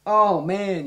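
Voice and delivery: loud, high mock alarm; startled outburst, retold; retold shock. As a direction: gasp it out as if just seeing blood. Retelling shock at blood.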